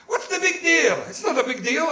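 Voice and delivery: high-pitched mock defensiveness; defensive protest. Mother-in-law caught smoking indoors, defensive.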